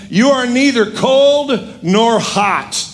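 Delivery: high, slow pronouncement; scripture declaimed as a stern rebuke; preached rebuke. Acted. Preacher voices Jesus rebuking church.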